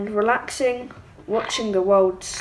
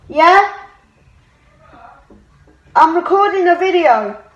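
raised voice, higher pitch, to someone off mic; calling out; 6 semitones higher, 7 dB louder than usual. Real, not acted. Gamer answers mum while recording, calling out. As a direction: call across to mum.

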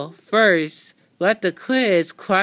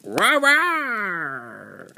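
lion roar. Pretend. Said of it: "Mister Lion".

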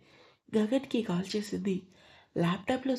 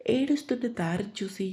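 ordinary delivery, nothing marked

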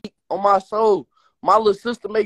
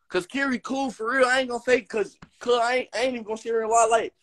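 ordinary talk, nothing marked

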